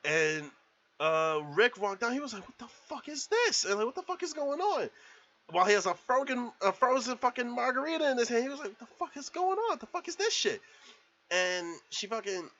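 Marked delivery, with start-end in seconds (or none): 2.5-3.6 very high mock disbelief; bewildered outburst. Rick walking in on chaos, high-pitched bewilderment. 3.9-4.9 very high mock disbelief; bewildered outburst. Rick walking in on chaos, high-pitched bewilderment. 8.7-10.6 very high, quick mock disbelief; bewildered outburst. Rick holding his margarita, high bewildered outburst.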